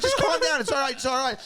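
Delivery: high-pitched, hammed-up calming down; calm the kids while panicking yourself; mock-frantic soothing; frantic reassurance. Imitates himself shushing panicking kids.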